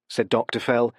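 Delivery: plain reading voice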